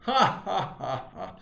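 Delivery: high, loud burst of fake laughter; mocking bully laugh. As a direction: jeer "ha ha" like a playground bully. Bully's laugh acted out again.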